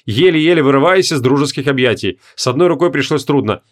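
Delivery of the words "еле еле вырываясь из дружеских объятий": usual speaking voice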